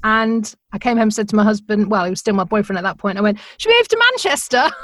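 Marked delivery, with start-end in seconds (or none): 3.6-4.7 excited question. Retold or imitated. Asking boyfriend to move.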